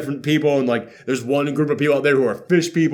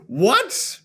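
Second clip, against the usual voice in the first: ordinary talk, nothing marked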